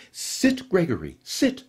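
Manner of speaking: a crisp high command to the dog; a prim lady ordering her dog to sit. A narrator's reading. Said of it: "she commanded".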